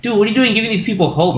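high, quick imitation of disbelief; mock incredulous. Retold officer questioning Schindler about hope, mock incredulous.

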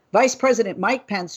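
usual speaking voice

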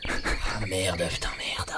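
whispered swearing under breath; muttered cursing. Acted. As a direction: curse quietly to yourself. Son gone, swearing to himself.

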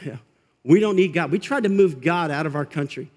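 usual speaking voice

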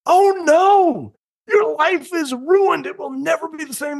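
high, hammed-up panicky parent; mock the frantic overreacting parent; panicked parent. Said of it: "that is one of the mistakes that we make as parents".

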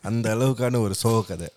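usual speaking voice

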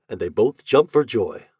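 usual speaking voice

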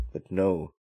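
normal talking voice

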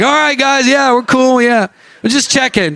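high, placating reassurance; sheepish backing down. Retold or imitated. Visitor backing down to worship leaders, high and placating. Backing down after being challenged.

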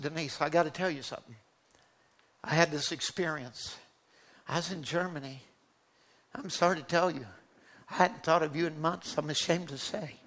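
nervous, hushed. Retold or imitated. Preacher pulling the young woman aside, hushed and nervous. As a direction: confess it gently, almost under your breath. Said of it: "so flustered and nervous".

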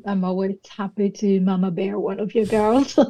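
ordinary talk

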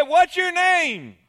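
high, loud, slow shout; little boy yelling into a cave; child calling out. Acted. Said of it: "He said".

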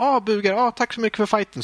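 mock-cute fighter voice; sweet game girl. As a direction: imitate a cute character bowing. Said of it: "lite så här snäll".

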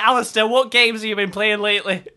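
loud mock-dad scolding in Scottish; Scottish accent. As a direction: lecture like an exasperated Scottish father. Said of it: "And he'd be like".

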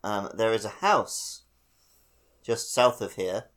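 usual speaking voice

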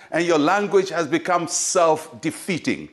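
normal talking voice

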